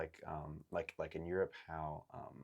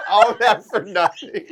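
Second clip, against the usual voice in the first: laughing; 23 dB louder, slower than usual. Real, not acted. Laughs at wasted dice roll.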